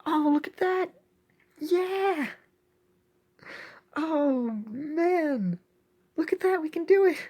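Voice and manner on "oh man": ordinary talk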